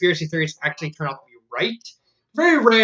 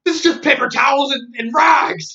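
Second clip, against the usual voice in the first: higher, louder outraged call-out; accusing shout; 8 semitones higher, 4 dB louder than usual. Retold or imitated. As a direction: expose the fraud to the crowd. Chris exposes the sham product.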